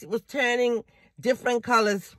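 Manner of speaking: ordinary talk, nothing marked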